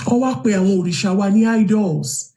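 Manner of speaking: ordinary talk, nothing marked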